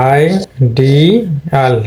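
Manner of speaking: Ideal very slow with the stress on ডি. Shown as a demonstration. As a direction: lean on ডি in ideal. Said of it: "এর উপরে stress পড়েছে ডি". (ডি-তে জোর দিয়ে ধীরে ideal)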